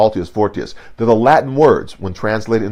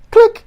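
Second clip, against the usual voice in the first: sharp high mouth click like a shutter; mock shutter sound; camera click; 10 dB louder than usual. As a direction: snap the word out, very high and loud. Imitating camera snapshot.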